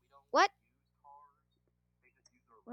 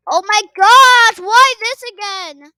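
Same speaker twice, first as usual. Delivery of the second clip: loud exasperated whine; fed up and shouting; frustrated yell; 4 semitones higher, 12 dB louder than usual. Real, not acted. Traps again.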